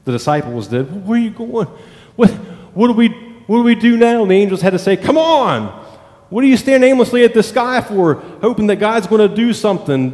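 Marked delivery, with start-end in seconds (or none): 1.1-4.2 higher-pitched, stammering questions; bewildered disciples. Acted. 5.0-10.1 high, impatient chiding; exasperated angels. Acted. Angels chide the idle disciples.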